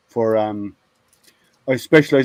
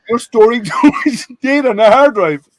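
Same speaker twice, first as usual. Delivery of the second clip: higher and louder, laughing through the words; 9 semitones higher, 6 dB louder than usual. Real, not acted. Telling his colleagues how he fooled the VP, laughing. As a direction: repeat the line, cracking up.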